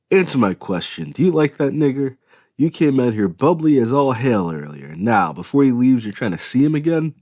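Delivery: loud, angry fatherly accusation; accusing father. A narrator's reading. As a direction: demand an answer, furious. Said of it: "her father accused Piper".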